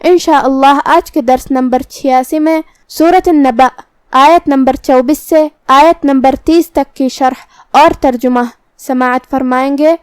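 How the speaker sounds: normal talking voice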